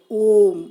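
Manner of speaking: ओम held very long and loud, a drawn-out chant; prolonged om. Shown as a demonstration. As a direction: sustain ओम for triple length. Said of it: "ओम में अधिक समय लगा". (ओम को तिगुना लंबा खींचकर)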